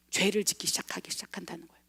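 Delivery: sudden hushed whisper for effect. Real, not acted. Preacher whispers to the congregation about sin beginning, hushed. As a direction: drop to a breathy hush on this line.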